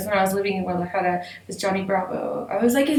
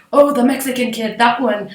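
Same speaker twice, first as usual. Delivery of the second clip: mocking classmates; 4 semitones higher, 7 dB louder than usual. Imitating classmates labelling her.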